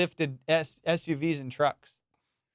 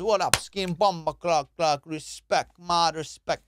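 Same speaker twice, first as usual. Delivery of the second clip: slow, lightly put-on Jamaican accent; slower than usual. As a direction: greet the son's friend Jamaican style. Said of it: "He goes".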